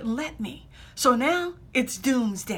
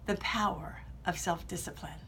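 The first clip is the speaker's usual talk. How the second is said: much softer whispered close; dramatic whisper; 7 semitones lower, 11 dB quieter than usual. Acted. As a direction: whisper the final words for effect. Speech closing, dramatic finish.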